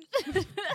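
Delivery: sudden high burst of laughter. Real, not acted. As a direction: squeal a laugh. Laughs at joke.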